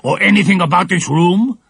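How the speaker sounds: suspicious villain. A narrator's reading. Shaggy man questioning the girls, suspicious. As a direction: press them suspiciously. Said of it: "asked the shaggy man".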